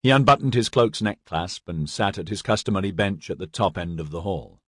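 plain reading voice

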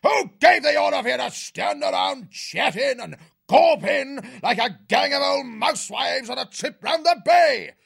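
high, loud bullying bellow; shouting officer. A narrator's reading. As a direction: roar the rowing orders over the clamour. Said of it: "Cuthbert's shouts rang out above the clamour".

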